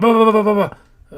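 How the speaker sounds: loud, high frantic babble; funny voice. Retold or imitated. Imitating a frantically flapping animated guy, funny voice. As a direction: flap out silly syllables loud and high. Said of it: "doing this funny voice".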